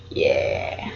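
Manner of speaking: breathy, unvoiced whisper. Real, not acted. Whispered aside.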